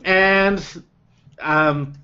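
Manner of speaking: ordinary talk, nothing marked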